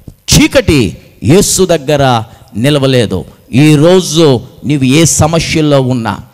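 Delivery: usual speaking voice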